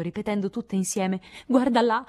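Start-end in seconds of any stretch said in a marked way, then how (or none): 1.5-2.0 forte e acuto, trionfante; grido trionfante. A narrator's reading. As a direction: gridalo tutta esultante. (sorelle che trascinano Beth in salotto, grido festoso)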